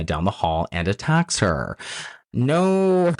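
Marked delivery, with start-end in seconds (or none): 2.3-3.1 mock dismay. Mock dismay at film's attack.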